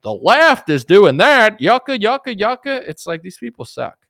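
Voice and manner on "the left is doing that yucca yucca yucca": much higher and louder, slow hammy finish; mock Tim Scott. Mocking a rival politician's rally line, loud high parody. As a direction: belt the punchline like a pandering rally speaker. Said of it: "He'll be like".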